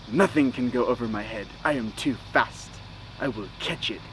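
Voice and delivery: lightly voiced hulking literal warrior; imitating Drax. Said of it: "Drax, Batista was all like".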